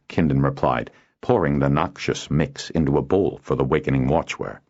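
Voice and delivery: ordinary delivery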